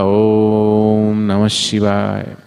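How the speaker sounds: low, very slow demon chant; deep demon voice. Acted. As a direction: draw the mantra out deep like a demon. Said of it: "ऐसी राक्षसों की मोटी आवाज होती है ना". (राक्षस की मोटी धीमी आवाज़)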